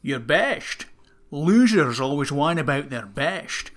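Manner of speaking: slow, slurred Scottish accent; Sean Connery. Shown as a demonstration. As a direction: do a hammy Scottish film-star accent, drawing words out. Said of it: "you do this accent pretty good".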